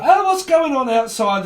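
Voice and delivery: nosy neighbour alarmed by outside chaos; mock alarmed neighbour. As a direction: play a flustered busybody. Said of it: "there's somebody like".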